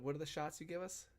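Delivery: ordinary talk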